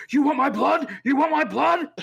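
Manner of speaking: high, loud, oddly inflected demand; weird inflection. Retold or imitated. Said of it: "he had, like, some weird inflection".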